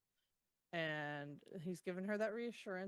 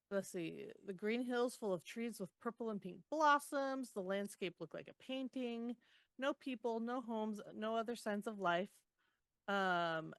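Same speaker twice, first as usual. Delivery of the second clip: normal talking voice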